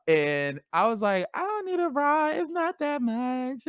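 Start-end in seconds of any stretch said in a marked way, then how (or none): 1.3-3.7 much higher, squeaky denial; high, then slowing as she waves it off; high protest. Retold or imitated. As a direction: brush it off in a high girly voice. High-pitched denial to friend.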